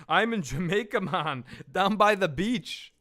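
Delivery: mock Jamaican accent; lilting, slow comic Jamaican twang; Dave Chappelle. Quoting a stoner film to a co-host, mock Jamaican accent. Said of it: "Dave Chappelle's accent in Half Baked".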